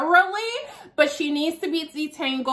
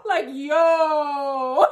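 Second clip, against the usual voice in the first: mock slang. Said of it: "I don't even speak like that".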